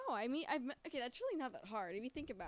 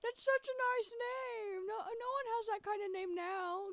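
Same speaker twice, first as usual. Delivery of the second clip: high, loud mock-sentimental gush; exaggerated near-tearful swoon over an old-fashioned name; gushing stranger; 13 semitones higher, 7 dB louder, slower than usual. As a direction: overdo it like a gushing fan of old names. Retold people gushing over name.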